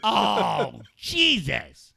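exaggerated exasperated reproach; mock appalled. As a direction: scold a friend in hammed-up disgust. Mock disgust at crude joke.

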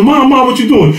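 high, startled "Mom, Mom"; shocked plea. Retold or imitated. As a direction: cry out to mom mid-hit. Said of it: "I'm like".

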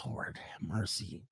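slow, breathy whispered exclamation; awed whisper. Retold or imitated. Streamer reacting to CrossFit competitions, awed whisper. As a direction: whisper it slowly under your breath.

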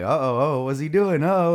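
rising, loud mock alarm from the crowd; alarmed onlookers. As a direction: imitate people gasping as he stumbles up. Onlookers reacting to him vomiting.

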